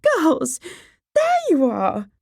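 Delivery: very high, loud, fluttering mother; shrieky, high-pitched greeting; excited cry. Acted. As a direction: call out to your daughters in excitement. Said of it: "cried Missus Bennet".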